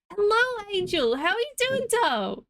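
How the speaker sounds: sweet, high, hammed-up hello; cutesy greeting voice. Sudden sing-song greeting voice.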